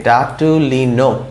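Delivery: ডাটুলিনোপ drawn out, closing on P; slow sounding-out. Shown as a demonstration. Teacher adding a P to the word, slow sounding-out. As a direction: stretch the syllables up to NOP. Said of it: "তারপর একটা পি যদি দেই".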